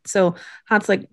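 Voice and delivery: normal talking voice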